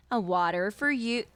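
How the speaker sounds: put-upon waitress handing over water; hammed-up rude waitress. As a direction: plunk it down with mock contempt. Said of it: "I'm hamming it up right now".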